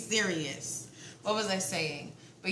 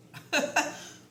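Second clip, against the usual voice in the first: real high giggle; 10 semitones higher, 4 dB louder than usual. Real, not acted. Reader cracking up over the reading. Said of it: "I got the giggles".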